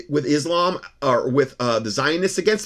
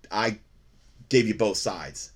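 normal talking voice

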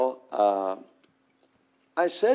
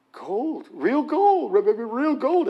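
high stammered real gold in awe; stuttering amazement; 8 semitones higher than usual. Retold or imitated. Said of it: "he's stuttering and he's saying".

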